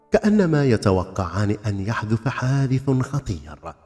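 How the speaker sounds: ordinary delivery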